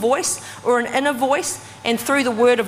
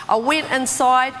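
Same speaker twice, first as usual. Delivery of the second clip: ordinary talk, nothing marked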